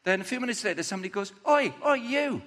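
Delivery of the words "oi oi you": high-pitched accusing heckle; acted bystander's shout of recognition; calling out. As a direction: call across to someone you recognise. Bystander calls out to Peter.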